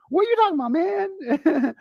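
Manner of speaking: very high, fast quoted banter; high-pitched friend impression. Imitating his best friend from Brooklyn, high and fast. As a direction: imitate an excitable pal, voice far up. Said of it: "might need to get his voice box checked".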